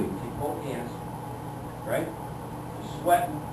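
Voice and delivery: whispering. Acted. Tense drive, cop behind.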